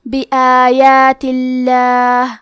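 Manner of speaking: بی آیات اللہ slow, the لام in اللہ kept light and thin; بی آیات اللہ, the لام unemphasised; thin lam. Shown as a demonstration. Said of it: "لام کو پتلی آواز میں پڑھیں گے".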